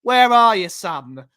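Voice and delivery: loud cheeky call, falling off on son; imitating Perch. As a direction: bark it like a mate on the phone. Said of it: "that's how Perchy talks off stream".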